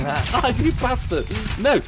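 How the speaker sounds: loud angry outburst; angry shout. Real, not acted. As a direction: yell it at the killer. Killed in game.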